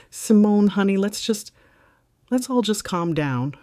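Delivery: panicked pleading. A narrator's reading. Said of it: "A weak, panicked bleat of a sound".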